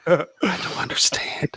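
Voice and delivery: whispering in confusion. Pretend. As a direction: keep it a hushed whisper. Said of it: "Why are we whispering?".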